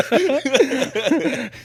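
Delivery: high, slow words squeezed out through laughter; laughing. Real, not acted. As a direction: laugh the question out loud. Laughs at own advice.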